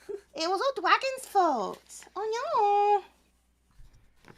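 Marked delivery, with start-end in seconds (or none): 0.1-3.0 put-on high, slow baby-talk Owo voice. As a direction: coo it in cutesy baby talk. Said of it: "the Owo voice".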